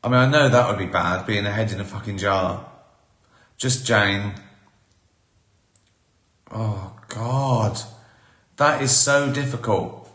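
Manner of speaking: ordinary talk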